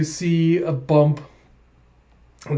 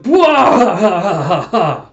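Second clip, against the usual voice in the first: loud, higher mock crowing; gloating outburst; 4 semitones higher, 8 dB louder than usual. Retelling a player gloating at rivals, mock crowing. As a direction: blurt it out big and drawn out.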